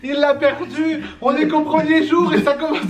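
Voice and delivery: high, teasing exclamation; moquerie amusée. Real, not acted. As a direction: tease the partner in a high, amused voice. Taquine son partenaire, rires. (taquinerie aiguë et amusée)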